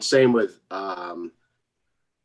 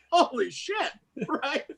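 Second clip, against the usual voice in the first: laughing disbelief; 12 semitones higher, 4 dB quieter, slower than usual. Retold or imitated. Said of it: "I'm going".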